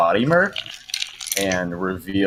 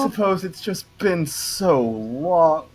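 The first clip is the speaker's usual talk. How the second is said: doting demon lady; 13 semitones higher than usual. Acted. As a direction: swoon over her lost husband. Agatha gushing over Asmonis.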